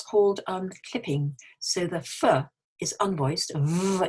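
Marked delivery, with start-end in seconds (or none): 3.5-4.1 "v" with audible vibration; sustained voiced "v". Shown as a demonstration. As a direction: buzz the "v" with the voice on. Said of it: "you've got the vibration".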